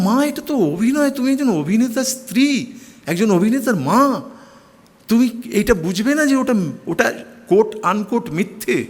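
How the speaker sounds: high, insistent protest to mother; son's high, earnest protest to his mother; pleading protest. Retold or imitated. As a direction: plead with her that it is only acting. Pleading with mother about acting.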